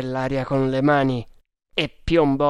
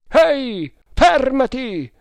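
high, loud hail; shouted order to stop; 11 semitones higher, 7 dB louder, slower than usual. A narrator's reading. Voice from the riverbank to the boat, loud high shout. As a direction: holler it across the river, pitch leaping. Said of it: "ripeté la stessa voce".

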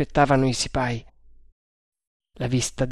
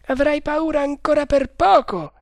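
a higher voiced guard, confident; overheard guard; 13 semitones higher, 3 dB louder than usual. A narrator's reading. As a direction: play the first guard, sure of himself. (guardia sicura di sé, voce più alta)

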